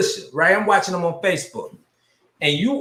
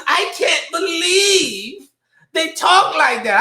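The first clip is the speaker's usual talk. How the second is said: much higher, drawn-out incredulous voice; imitated disbelief; 11 semitones higher, slower than usual. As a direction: play a friend's high-pitched disbelief. Acting out friend's shocked reaction.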